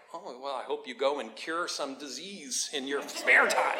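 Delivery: slow, drawn-out mock well-wishing; zing them with slow, fake sweetness; drawn-out sarcasm; sarcastic zinger. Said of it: "I would be so sarcastic".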